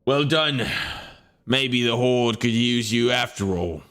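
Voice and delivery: NPC voice. Acted. GM voicing NPC praising party.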